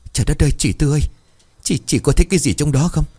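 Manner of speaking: high, stammered alarm; nervously seek confirmation from a companion; sudden fright. A narrator's reading. Woman tugs at her companion's arm, frightened high voice. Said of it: "đột nhiên kéo tay người bên lại".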